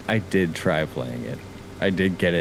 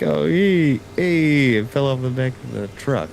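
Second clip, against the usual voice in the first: higher, louder mob guy call; mobster delivery guy; 7 semitones higher, 4 dB louder than usual. Retold or imitated. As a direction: hail it like a shady mobster. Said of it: "And be like".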